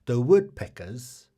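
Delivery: loud, projected fact reading; fact announcer. Real, not acted. Quiz host reading trivia to the audience, loud announcer delivery. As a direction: announce each phrase loudly, with clear pauses.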